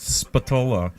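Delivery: Spatola as spuh-TOH-luh, drawn out; American pronunciation. Shown as a demonstration. Host explaining the family name, American spuh-TOH-luh for Spatola. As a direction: stress the middle of Spatola, American style. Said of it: "If you're American, you probably".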